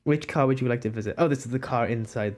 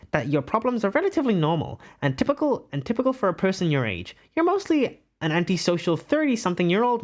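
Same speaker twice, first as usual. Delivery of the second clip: higher, swooping theatrical delivery; put-on character voice; 4 semitones higher than usual. Streamer reads a quiz result to viewers in a put-on voice. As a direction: do a put-on voice for a grand greeting. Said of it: "let me do this in a voice".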